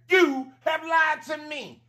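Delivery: high, slow, sassy shouting; playful sassy shout. Pretend. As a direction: call them out with mock outrage. Playful mock accusation, shouting.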